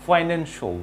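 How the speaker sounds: "ফাইন্যান্সিয়াল" ending in a short "sho"; correct pronunciation. Shown as a demonstration. Teacher models the word for learners, correct pronunciation. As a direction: say "ফাইন্যান্সিয়াল" as "fai-NEN-sho". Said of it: "এটির pronunciation".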